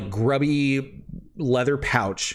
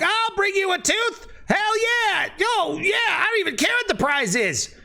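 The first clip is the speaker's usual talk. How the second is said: much higher, eager goblin yelling; excited redcap shouting. Acted. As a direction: shout it like a greedy little monster hungry for the prize. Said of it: "a number of the red caps are all shouting".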